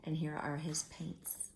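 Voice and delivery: soft whisper while pointing at the picture; whispered aside. Real, not acted. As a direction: hush it to the listener.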